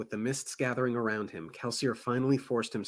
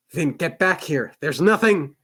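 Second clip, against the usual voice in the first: shouted call into the dark, louder and higher; 4 semitones higher, 8 dB louder than usual. Acted. Kelsier calling after Vin in the dark, raised shout. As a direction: yell after her down the corridor.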